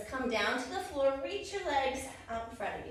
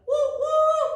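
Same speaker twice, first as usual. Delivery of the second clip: high, loud hooting woo; train whistle; 13 dB louder than usual. Pretend. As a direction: hoot like a steam train.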